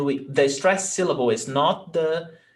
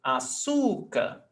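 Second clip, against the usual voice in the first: "Açúcar" slow and higher, middle syllable stretched; penultimate stress; 4 semitones higher, 3 dB quieter, slower than usual. Shown as a demonstration. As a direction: say "Açúcar" as a-SUU-car. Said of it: "it's the before last".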